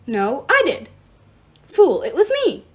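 loud squabbling claims; arguing. A narrator's reading. Children of Nyx arguing over credit, loud squabble. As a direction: snap back over a rival, loudly. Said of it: "Dozens of voices argued".

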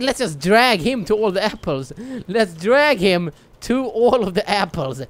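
ordinary talk

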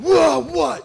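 high loud startled yelp. Retold or imitated. Dad woken by five-year-old, startled yelp. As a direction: jump awake in alarm. Dad jolted awake by son.

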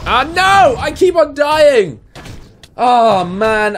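high, exasperated wail at dying again; frustrated cry. Real, not acted. Dies again in game.

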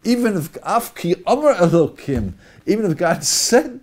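loud, high Even if, pressed; stressed inflection. Shown as a demonstration. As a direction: hammer Even if home. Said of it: "different inflection".

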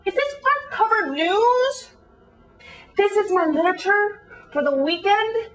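incredulous questioning. Acted. Reader appalled by the weekend cover story, incredulous. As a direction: question it in disbelief, phrase by phrase.

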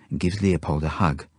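plain reading voice

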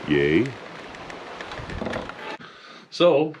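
usual speaking voice

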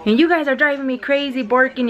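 put-on scolding like children. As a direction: scold the dogs like naughty kids. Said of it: "Yo regañándolos como si fueran niños". (regaño de mamá harta, imitado)

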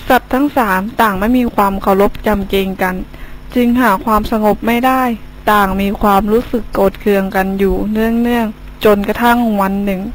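steady fable narration; story narration. A narrator's reading. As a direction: tell the fable to children.

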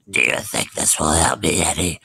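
a hint of a low, croaking leper; sickly hobo creeping toward the boy. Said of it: "I'll do his voice".

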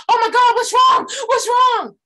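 mock panicked high shout; alarmed relatives. Family member on the phone, panicked high shout. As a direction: play the worried family member yelling into the phone. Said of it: "they be like".

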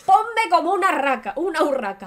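hammed-up vain groom; showy demand. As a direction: demand sparkle like a diva. Aladdin demanding bling.

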